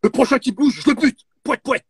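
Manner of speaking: high, loud silly threat; angry. Acted. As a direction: yell the threat like a clown. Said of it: "c'est bien, franchement, bravo".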